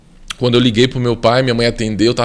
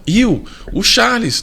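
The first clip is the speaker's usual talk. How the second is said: calling out; 9 semitones higher, slower than usual. Retold or imitated. Mother calls out to father.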